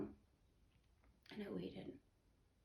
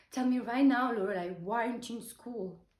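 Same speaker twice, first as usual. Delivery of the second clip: loud retold scolding, easing off toward the end; furious father; 8 dB louder than usual. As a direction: imitate an angry father demanding an answer, hitting the first words hard. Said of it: "And he was furious".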